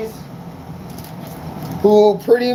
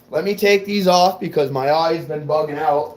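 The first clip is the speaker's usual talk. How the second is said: ordinary talk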